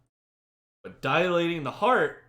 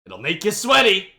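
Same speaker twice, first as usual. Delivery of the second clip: infomercial voice; 4 semitones higher, 8 dB louder than usual. Pretend. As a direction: hype the product like a TV ad. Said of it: "my infomercial voice".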